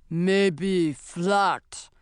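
oafish troll shifting blame. Acted. Troll blaming another troll, oafish troll voice. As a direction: speak as a dim troll.

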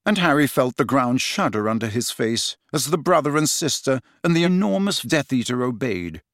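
ordinary delivery, nothing marked

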